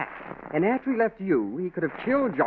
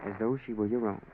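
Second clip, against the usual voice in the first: letter read aloud; 4 semitones lower, 5 dB quieter than usual. Acted. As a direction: read it like a confession from the grave. Reads the dead man's letter.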